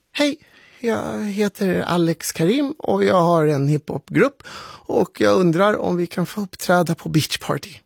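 put-on high, polite child voice; young boy on phone. Boy asking organiser for a gig, high polite voice. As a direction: pitch up into a hesitant young caller.

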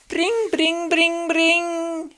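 high sing-song bring bring like a bell; school bell ring. Pretend. Mimics school bell ringing. (koulun kellon soitto korkealla äänellä)

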